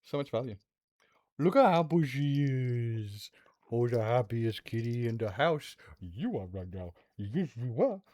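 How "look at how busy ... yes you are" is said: soft, quiet cooing to the cats; pet talk. Real, not acted. Streamer cooing softly to her cats Teo and princess. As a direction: hush and coo like talking to a pet. Talking to the cat.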